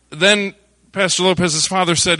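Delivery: ordinary delivery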